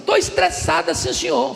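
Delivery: preacher mimicking a child insisting she is stressed; girl's voice. Pastor voices the girl insisting.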